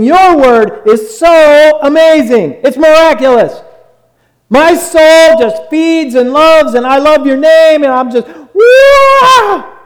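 loud, high ecstatic shout of praise; exuberant, over-the-top worship outburst; high-note praise. Acted. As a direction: start and end on a whooping wow, gushing in between. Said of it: "like, on this high note, just praising God".

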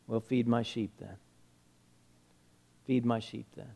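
soft, low, tender voice; gentle acceptance. Retold or imitated. Jesus restoring Peter after his denials, gentle acceptance. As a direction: speak tenderly, voice dropped low.

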